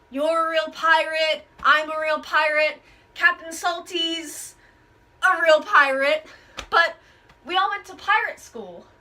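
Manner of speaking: ordinary talk, nothing marked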